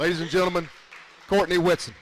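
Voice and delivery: announcer introduction. Acted. Radio host presenting the retiring player to fans, booming announcer call. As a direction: present her like an arena announcer.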